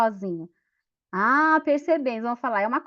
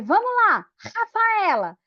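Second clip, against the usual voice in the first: loud, high cheer for a classmate; cheering call; 8 semitones higher, 5 dB louder than usual. Retold or imitated. Teacher cheering the pupil Rafaela on in the game, loud excited call. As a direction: shout her name to urge her on.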